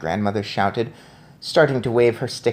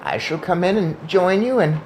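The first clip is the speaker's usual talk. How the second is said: ordinary delivery, nothing marked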